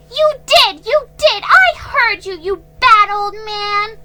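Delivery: put-on high, loud child's accusing shout; little girl yelling at the old man; crying out. A narrator's reading. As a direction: shriek the accusation. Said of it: "Nan cried out".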